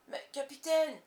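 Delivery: loud, high objection; startled protest. A narrator's reading. Pirate objecting to the captain's order, startled protest. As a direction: exclaim it in dismay.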